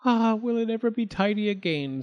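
high, tired mouse voice; high weary little mouse. Acted. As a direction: sigh over the mess. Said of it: "said poor Missus Tittlemouse".

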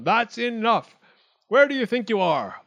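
high, loud scolding shout. A narrator's reading. Wildcat cuts off chattering acorns, high shout. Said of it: "Wildcat shouted".

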